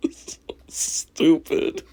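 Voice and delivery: slow whisper through laughter. Real, not acted. Mocking the answer in a laughing whisper. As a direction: breathe it out laughing.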